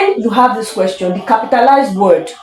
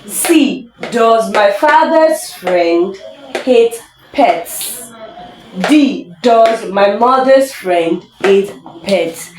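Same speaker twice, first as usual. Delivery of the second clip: very slow, deliberate question reading; exam read-aloud; 2 semitones higher, much slower than usual. Shown as a demonstration. Teacher reads out the exam options to students, slow deliberate read-aloud. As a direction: spell out each option word by word, slowly.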